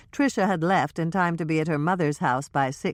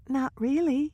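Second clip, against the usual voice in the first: put-on higher young woman's voice, hushed gossip; Trisha's voice; 11 semitones higher than usual. Trisha confiding to Hannah and Michelle, higher nervous voice.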